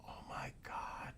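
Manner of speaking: breathless whispered oh my God; barely voiced, stunned; awed whisper. Real, not acted. Reacting to buried people.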